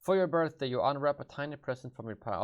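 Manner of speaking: usual speaking voice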